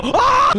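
sudden high scream; real scream at a jump scare; startled scream. Real, not acted. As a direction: shriek in fright.

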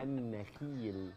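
slow and clear. Shown as a demonstration. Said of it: "طلع لسانك".

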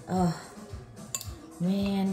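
usual speaking voice

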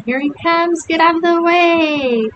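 high-pitched alarmed yell, sustained to the end. A narrator's reading. Alan and Joe fleeing up the hill, yelling. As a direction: yell a high, urgent warning.

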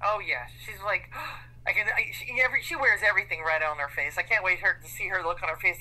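ordinary delivery

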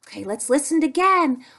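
usual speaking voice

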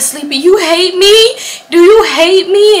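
high, loud, drawn-out whine; hammed-up wounded question; drunken pleading. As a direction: plead slowly like a needy drunk. Acts her drunken plea to friend.

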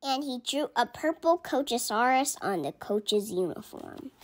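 ordinary delivery

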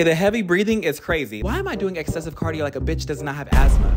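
usual speaking voice

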